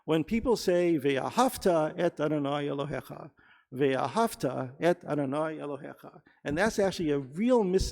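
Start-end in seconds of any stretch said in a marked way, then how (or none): 1.0-6.2 "Ve'ahavta" stressed on HAV, with a light final "ta"; stress on second-last syllable. Shown as a demonstration. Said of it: "important mispronunciation of that word".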